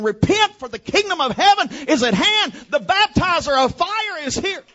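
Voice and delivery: high, loud proclaiming call, half sung; prophetic cry. Retold or imitated. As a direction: thunder it like a prophet in the desert. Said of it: "looking into the camera and saying".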